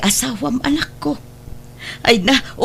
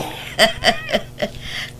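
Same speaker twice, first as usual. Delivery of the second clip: joyful laugh; 7 dB quieter than usual. Acted. Grandmother overjoyed at the reunion.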